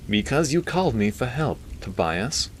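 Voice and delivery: high nasally retort; reply through the nose, easing on the name; Milton. A narrator's reading. Doctor reminding the priest who called him, nasally. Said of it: "He had a nasally voice".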